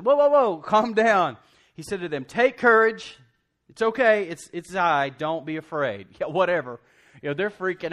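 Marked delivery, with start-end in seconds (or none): 0.0-1.4 loud, high, urgent interjection; calling for calm. Acted. Jesus calling to freaked-out disciples, loudly urging calm. 2.2-6.1 loud, raised call, easing toward the end; urgent reassurance. Acted. Jesus to terrified disciples, loud urgent reassurance.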